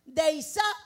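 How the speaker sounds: loud preacher proclaiming the story; full-force preaching. Acted. Preacher retelling Elijah's altar to the church, full-force preaching.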